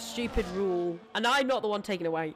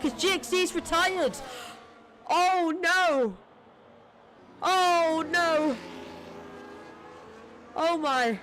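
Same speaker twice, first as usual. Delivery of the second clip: shocked dismay; 5 semitones higher than usual. Real, not acted. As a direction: cry out as the car crashes. Driver crashes out, safety car.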